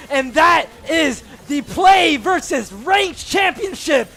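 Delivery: climbing to a shouted championship call; victory call. Real, not acted. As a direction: shout the title win to the crowd. Calls the championship-winning play.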